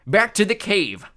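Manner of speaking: loud high giant shout; cyclops shout. A narrator's reading. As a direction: bellow the order. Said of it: "he shouted".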